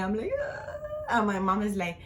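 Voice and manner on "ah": high-pitched mock wail; imitated crying wail. Woman in labor in the car, imitated crying. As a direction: imitate yourself wailing in labor. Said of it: "I'm just crying and crying".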